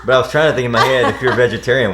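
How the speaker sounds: laughing. Real, not acted. Husband explains his stutter to his wife, laughing. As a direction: talk quickly and loudly through laughter.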